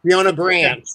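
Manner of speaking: emphatic American-accented name. Real, not acted. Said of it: "look at that American accent coming through".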